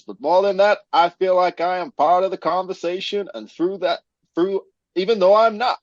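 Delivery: high-pitched Australian attempt landing as American South; bad Aussie accent like a Confederate general; botched Australian accent. Host reading out a listener's review, Australian gone Southern American. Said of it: "you were Confederate General [name]".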